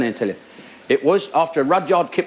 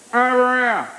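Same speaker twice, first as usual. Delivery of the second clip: heckler; 6 semitones higher, slower than usual. Retold or imitated.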